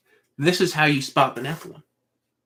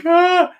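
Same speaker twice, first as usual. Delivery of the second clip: a sudden high, loud yelp of fright; startled scream; 8 dB louder than usual. Retold or imitated. Hiding from the possessed wife, he lets out a high scared yelp. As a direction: shriek as if caught. Terrified at her footsteps.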